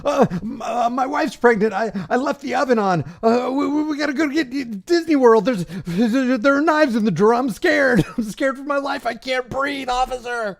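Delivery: high, whiny, stammering mock plea. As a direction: whimper like a scared little girl. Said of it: "he's about to squeal like a little girl".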